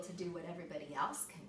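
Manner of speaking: fast, hushed whisper of despair; a hurried bitter whisper inside his head; whispered thought. A narrator's reading. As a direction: whisper it quickly to yourself. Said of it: "He thought".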